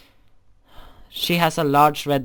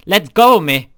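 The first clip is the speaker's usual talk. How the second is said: sharp demand; 9 dB louder than usual. Acted. Pulling free from her.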